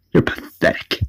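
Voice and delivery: breathy scornful whisper; contemptuous whisper. Retold or imitated. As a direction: sneer it under the breath. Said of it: "Avesta's like".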